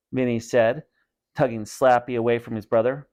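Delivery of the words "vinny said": ordinary delivery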